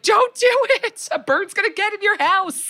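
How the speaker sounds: high shouted warning across the alley; calling out warning. Retold or imitated. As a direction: yell a warning to the neighbor. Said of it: "I wanted to be like".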